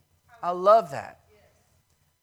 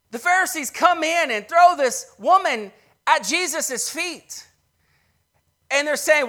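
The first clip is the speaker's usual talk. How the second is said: loud, rising preacher's cry; full-force sermon delivery; preaching at full force; 6 semitones higher, 9 dB louder than usual. Acted. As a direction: preach it out to the congregation.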